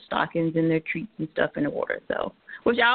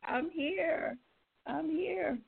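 frog voice; 8 semitones higher, 8 dB quieter, much slower than usual. Real, not acted. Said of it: "I sound like a frog".

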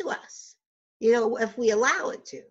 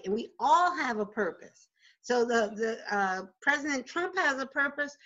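ordinary talk, nothing marked

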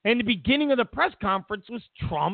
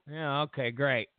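mock unimpressed; 5 semitones lower than usual. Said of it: "I'm gonna go".

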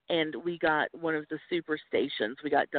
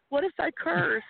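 much higher, anxious little question; worried question; 12 semitones higher than usual. Retold or imitated. Asking the friend who texted good luck, in anxious high worry. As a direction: fret it up high. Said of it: "And I was like".

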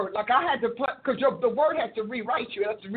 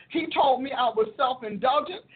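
ordinary talk, nothing marked

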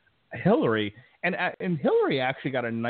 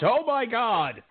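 high, drawn-out shocked cry; hammed-up horrified parent reaction; mock horror; 12 semitones higher, much slower than usual. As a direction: wail it high and slow, in pretend shock. Acts out parents watching shocking movie.

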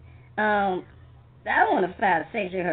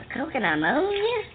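normal talking voice

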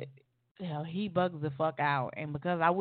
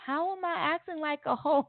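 much higher, incredulous squeak; baffled question; 11 semitones higher than usual. Retold or imitated. To her jealous boyfriend, baffled and much higher. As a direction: ask it in total disbelief. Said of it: "And I'm like".

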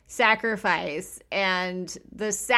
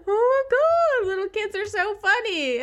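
high, loud laughter breaking into the words; helpless giggle at the story; real amused laugh; 4 dB louder than usual. Real, not acted. Cracking up at the punchline.

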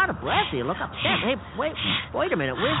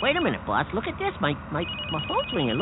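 ordinary talk

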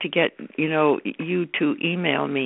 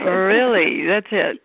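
ordinary delivery

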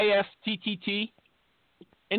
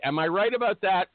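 usual speaking voice

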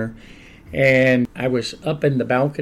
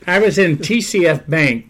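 words spoken through a real laugh, higher and louder; 5 semitones higher, 5 dB louder than usual. Real, not acted. Pastor beginning the bank story to the host, laughing. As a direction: start the story while still laughing.

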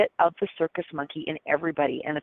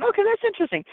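much higher, amused puzzled reaction; bemused okay; 14 semitones higher, faster than usual. Retold or imitated. Psychic reacting to the whimsical reading, high bemused tone. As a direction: react like it's a funny surprise. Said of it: "and I was like going".

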